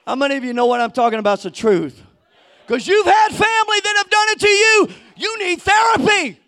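loud, high, shouted preaching; fiery revival preacher; preaching at full force. Acted. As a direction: preach it hard to the crowd.

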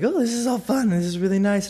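giddy little burst of anticipation; excited. Retold or imitated. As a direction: act out getting all excited. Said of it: "I'm getting all excited".

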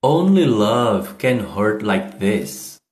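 Only love can hurt like this said very slowly, word by word; slow English model. Shown as a demonstration. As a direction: show the line slowly, not native speed. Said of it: "não vou falar como um nativo". (Only love can hurt like this, bem devagar e pausado)

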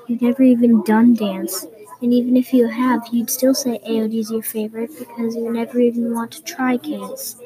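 ordinary delivery

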